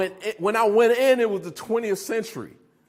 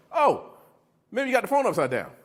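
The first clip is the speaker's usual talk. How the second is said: ordinary talk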